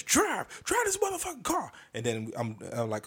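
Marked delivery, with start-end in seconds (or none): 0.0-1.7 much higher mock woman's urgent command; Stephanie ordering. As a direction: squeal the order like a panicked passenger. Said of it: "she says".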